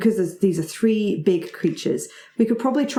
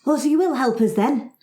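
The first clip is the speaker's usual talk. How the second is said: lightly voiced dwarf, raised; a hint of an eager dwarf asking; mildly higher hopeful dwarf; 7 semitones higher than usual. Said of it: "the dwarf says".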